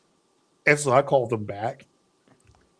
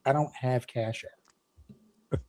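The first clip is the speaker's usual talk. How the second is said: very slow, soft, dry reply; deadpan; 4 semitones lower, 8 dB quieter, much slower than usual. Retold or imitated. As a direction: drag out the flat denial.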